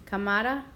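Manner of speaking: "cámara" as ca-MA-ra, with a rise; stress on middle syllable. Shown as a demonstration. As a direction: put the weight on MA in "cámara". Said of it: "listen to where we hear the stress".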